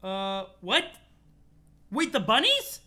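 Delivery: loud, high incredulous question; startled disbelief. Real, not acted. Reader startled by the bunnies in the story, incredulous. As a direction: blurt it out, voice jumping up.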